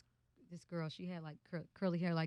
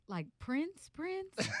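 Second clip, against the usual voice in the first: stunned disbelief; 12 semitones higher, much slower than usual. Retold or imitated. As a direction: ask it like you can't believe who's on the phone. Stunned it's really [name].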